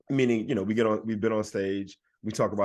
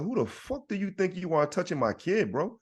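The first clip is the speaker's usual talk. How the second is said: retold indignant confrontation; 8 semitones higher than usual. Father to stranger touching his kid, retold indignation. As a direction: challenge him in disbelief, retold rather than shouted.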